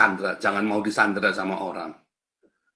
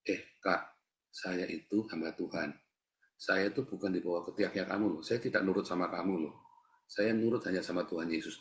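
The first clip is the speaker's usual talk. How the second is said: soft, restrained firm reply; quiet retold rebuttal; 2 semitones lower, 7 dB quieter than usual. Preacher tells an older colleague they serve God, quiet and restrained. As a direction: say it softly, standing your ground. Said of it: "Terus satu saya bilang".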